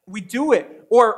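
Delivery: ordinary talk, nothing marked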